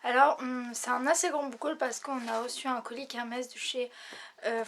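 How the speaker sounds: ordinary delivery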